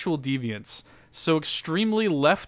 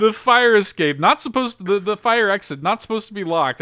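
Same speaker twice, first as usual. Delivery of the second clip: excited; 6 semitones higher, 5 dB louder than usual. Real, not acted. Excitedly stressing locked fire exit.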